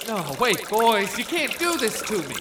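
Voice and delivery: pleading. Acted. Left tied up to drown.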